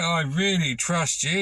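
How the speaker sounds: ordinary talk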